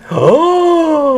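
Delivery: excited gasp. Acted. Mock amazement at news.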